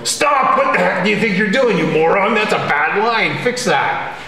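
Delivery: the brain's voice turned up, yelling at a bad line; loud bossy inner voice. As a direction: bark at yourself like a drill sergeant. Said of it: "turn the volume up on our voice and also turn up the strength".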